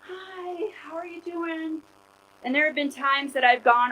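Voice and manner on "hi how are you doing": high, soft fake-bright hello; forced cheerful greeting. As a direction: greet the child sweetly while tired inside. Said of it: "And I'm like".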